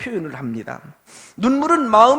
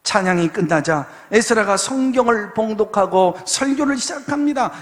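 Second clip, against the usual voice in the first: ordinary talk, nothing marked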